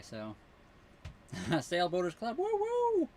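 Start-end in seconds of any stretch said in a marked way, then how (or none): 1.5-3.1 high, loud whoop of joy; excited cheer. Real, not acted. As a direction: cheer it out like a fan. Cheering the club name.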